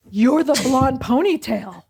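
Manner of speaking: slow, drawn-out realization; stunned recognition. Retold or imitated. Friend realizing her old rival, slow amazed recognition. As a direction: spell it out slowly, amazed.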